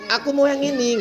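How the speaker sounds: put-on high-pitched demanding little kid; high, stubborn kid's whine; insistent child. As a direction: play a child who won't share. Voices child refusing to share.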